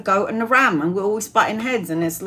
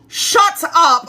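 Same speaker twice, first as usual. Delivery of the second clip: loud, high exclamation of disbelief; surprised shout; 6 semitones higher, 5 dB louder than usual. Real, not acted. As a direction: blurt it out in shock. Reacting to viewer's news.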